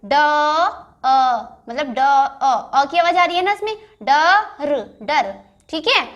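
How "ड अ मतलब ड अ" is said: ड and अ split apart and drawn out slowly; sounding out. Shown as a demonstration. Teacher breaking ड into its अ sound, slowly. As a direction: stretch ड then अ, slowly for the class. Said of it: "मैं कैसे पढ़ रही हूँ".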